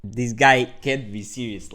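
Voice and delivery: can't believe what he sees; incredulous outburst. Retold or imitated. To the reader about his opponent, loud incredulous outburst. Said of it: "And I was like".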